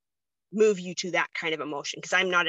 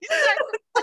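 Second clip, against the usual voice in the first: laughing cry; 6 dB louder than usual. Real, not acted. Laughing while retelling crying.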